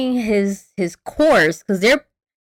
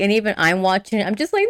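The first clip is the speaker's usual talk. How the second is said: usual speaking voice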